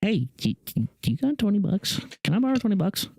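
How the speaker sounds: high, pleading mooch voice; whiny beggar. Pretend. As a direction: beg like a broke buddy. Begging friend for twenty bucks.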